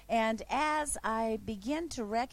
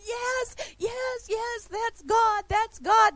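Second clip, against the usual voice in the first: high, ecstatic cries rising in volume; excited shouting; 12 semitones higher, 5 dB louder, slower than usual. Retold or imitated. Woman at the altar crying out in amazement, ecstatic. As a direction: shout it like she can't contain herself. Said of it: "the whole time she's going".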